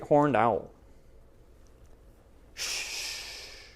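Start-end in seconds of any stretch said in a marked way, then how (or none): 2.5-3.6 sharp voiceless hush; owl's shush. Acted. Owl hushing the skunk before speaking. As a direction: hush the listener.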